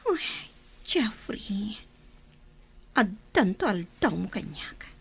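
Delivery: slow, hushed threat under the breath; revenge whisper. Acted. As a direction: whisper a grudge to the absent man. Alone, vowing revenge in whisper. (agar-arasaas a mangbutbuteng)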